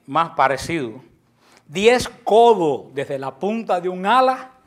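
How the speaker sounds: preacher reading about the cherubim aloud; scripture reading. Acted. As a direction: read the passage out to the congregation.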